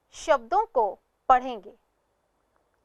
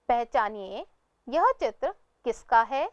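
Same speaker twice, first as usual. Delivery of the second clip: ordinary talk, nothing marked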